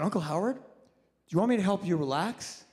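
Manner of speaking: cheerfully offering to help him relax; young doctor chirping at his sick uncle; chipper. Retold or imitated. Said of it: "all chipper".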